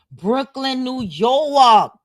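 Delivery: put-on loud, high New York accent with the R dropped in York. Streamer reading tour cities like a local, New York accent. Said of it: "say New York like I'm from that".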